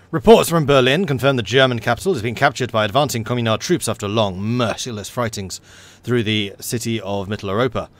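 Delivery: emphatic war bulletin delivery; news read. Acted. Game player reading news from Berlin, newsreader delivery. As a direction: announce it like a newsreel report. Reads news event aloud.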